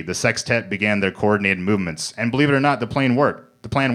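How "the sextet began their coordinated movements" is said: ordinary delivery, nothing marked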